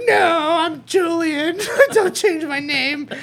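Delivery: put-on high, laughing kid protest; imitating upset boy. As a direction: play the little boy refusing his new name.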